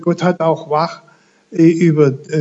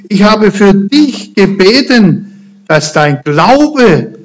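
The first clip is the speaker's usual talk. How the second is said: slow and personal; 2 semitones higher, slower than usual. Acted. Jesus promising Peter, slow and earnest. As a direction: speak it slowly, as a personal promise. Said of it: "Und jetzt wird's ganz persönlich".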